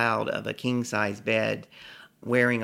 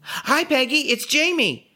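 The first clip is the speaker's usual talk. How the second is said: high, eager fan voice; starstruck greeting; 4 dB louder than usual. Retold or imitated. Drunk fan finally reaches Peggy, high giddy hello. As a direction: greet your idol in a giddy high pitch.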